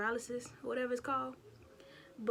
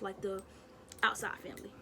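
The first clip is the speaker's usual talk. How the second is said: ordinary delivery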